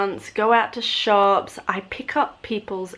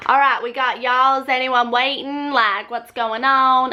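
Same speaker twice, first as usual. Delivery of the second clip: imitated Southern bus lady; Southern American accent; 4 semitones higher, 4 dB louder than usual. Bus lady checking on hotel guests, Southern American accent. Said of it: "there was a lady on the bus".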